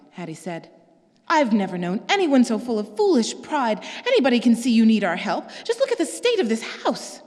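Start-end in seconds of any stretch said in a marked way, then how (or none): 1.3-7.2 rising angry scolding, pitch climbing; higher, louder exasperated scolding; agitated. Acted. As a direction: scold her sister's pride, heated. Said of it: "I'm a little agitated".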